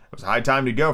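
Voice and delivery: ordinary delivery, nothing marked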